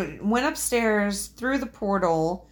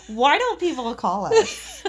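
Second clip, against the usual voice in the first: slow, high laughing question; slower than usual. Real, not acted. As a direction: laugh through the words. Laughing at their own joke.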